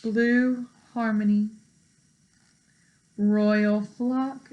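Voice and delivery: usual speaking voice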